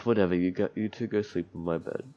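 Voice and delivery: ordinary delivery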